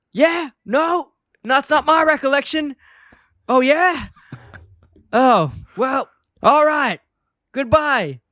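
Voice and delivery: high-pitched huffy replies down the phone; hammed-up indignation, pausing for the unheard caller; irate Homer. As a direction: argue in a high mock-angry voice, then give in. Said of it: "he's irate".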